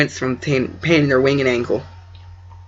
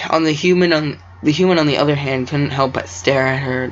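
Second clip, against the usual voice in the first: ordinary delivery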